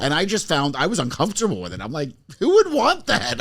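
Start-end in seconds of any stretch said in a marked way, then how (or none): 2.4-3.4 high-pitched, drawn-out disbelief; slow, high incredulous question. Retold or imitated. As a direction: recount your baffled reaction, pitched way up. Quoting his own baffled reaction.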